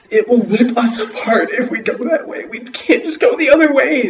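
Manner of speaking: high, loud, near-hysterical; terrified insistence. A narrator's reading. Boy warns his sister the creature will rip them apart, high and loud. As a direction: scream the danger at her. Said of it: "He's like".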